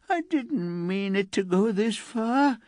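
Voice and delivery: put-on high, mildly wailing girl. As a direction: plead with a touch of tears. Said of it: "Elsa wailed".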